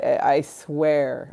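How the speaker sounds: usual speaking voice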